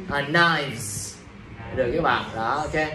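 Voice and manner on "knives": "knives" with a drawn-out voiced ending; plural ending pronounced. Shown as a demonstration. Teacher answering how the plural reads, ending pronounced. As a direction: say "knives" with V and a long Z. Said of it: "Đọc là gì giúp thầy".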